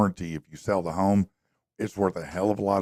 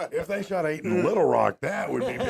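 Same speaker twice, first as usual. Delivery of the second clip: laughing; 9 semitones higher than usual. Real, not acted. Joking while laughing.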